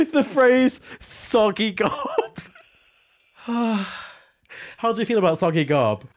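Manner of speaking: high laughing voice; words squeezed through laughter. Real, not acted. Laughing at absurd phrase.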